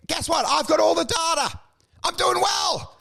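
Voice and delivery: high-pitched defiant mock tirade; re-enacted rant. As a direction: lash out at the crowd in retelling.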